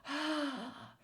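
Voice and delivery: high breathy wind howl; howling wind sound. A narrator's reading. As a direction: blow like a strong wind. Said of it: "sounds like a strong howling wind".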